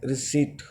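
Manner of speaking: "रिसीट" softly, with long ee and no p; correct silent P. Shown as a demonstration. Teacher repeating the correct form for students, silent P. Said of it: "ये रिसीट होता है".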